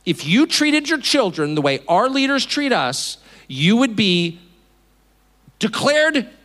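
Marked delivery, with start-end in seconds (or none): none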